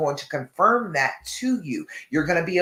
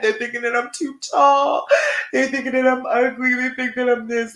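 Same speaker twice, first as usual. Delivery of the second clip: imagined critics' voice; 6 semitones higher, 3 dB louder than usual. Retold or imitated. Mocking imagined judgers' thoughts.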